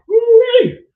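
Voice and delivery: loud high whoop of disgust; a shocked whooping holler; woo-wee shout. Retold or imitated. Overwhelmed by smell in motorhome.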